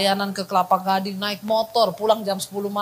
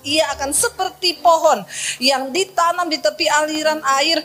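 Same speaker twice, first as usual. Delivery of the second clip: scripture reading; 4 semitones higher than usual. Acted. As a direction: proclaim the verse to the congregation. Reads scripture verse aloud. (membacakan ayat Alkitab dengan lantang)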